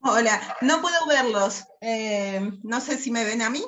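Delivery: normal talking voice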